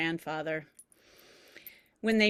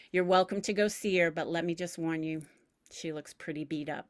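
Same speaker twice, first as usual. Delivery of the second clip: plain reading voice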